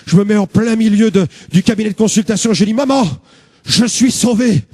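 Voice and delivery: ordinary talk, nothing marked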